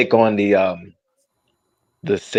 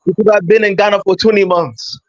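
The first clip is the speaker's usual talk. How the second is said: lightly put-on Ghanaian accent, higher; 10 semitones higher, 3 dB louder than usual. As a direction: tease them with a West African lilt. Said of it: "stop wearing the accent".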